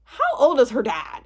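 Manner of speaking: normal talking voice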